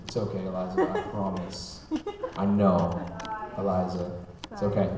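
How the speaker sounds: low, very soft comforting aside; real reassurance. Real, not acted. Speaker to Eliza who's looking at him, gentle reassurance. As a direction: gently soothe a worried little listener.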